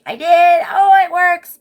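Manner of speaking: high, loud and thrilled; excited squeal. Real, not acted. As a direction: bursts out in delight. The popsicle mold works.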